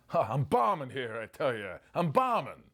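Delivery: lightly voiced nervous comic; a touch of Rodney's worried whine; Dangerfield complaining. Rodney Dangerfield complaining he's bombing, lightly voiced. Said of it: "He goes".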